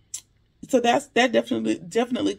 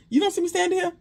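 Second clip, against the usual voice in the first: higher, fast, snappy comeback; quicker sassy retort; 10 semitones higher, faster than usual. Retold or imitated. Recapper's version of how Bayou should have answered, fast and sassy. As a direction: fire it back quick with attitude. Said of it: "That would've been".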